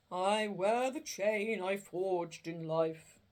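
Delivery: slow, loud, mournful spirit voice; a chained ghost lamenting its fate; doleful ghost. Acted. Marley's ghost lamenting to Scrooge, slow and doleful.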